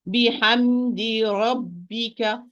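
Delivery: ربك with a heavy full-mouth ra, slow; slow بحمد ربك stressing the heavy ra; full-mouth ra demo. Shown as a demonstration. Teacher models heavy ra for student. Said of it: "ra with fatha, it's a full mouth".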